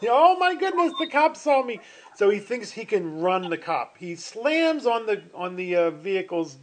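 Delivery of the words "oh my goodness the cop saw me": panicked driver. Acted. Speeding driver spots police.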